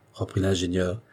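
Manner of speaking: ordinary delivery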